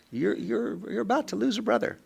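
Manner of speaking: high, quick mock threat to the brothers. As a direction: warn them half-joking, high and fast. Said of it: "Ooh, he's mad".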